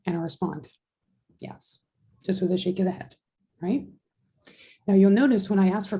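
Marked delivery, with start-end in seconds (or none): none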